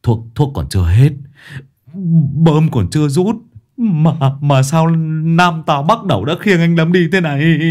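stammering, hammed-up tearful wail. A narrator's reading. Second addict friend lamenting Lâm's death, wailing and stammering. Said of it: "lại có thằng khác chu chéo to hơn".

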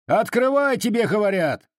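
high, insistent yell; shouting from outside. A narrator's reading. Cossacks in the yard shouting at the householder to open up. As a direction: call out an impatient order through the door. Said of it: "кричали с надворья".

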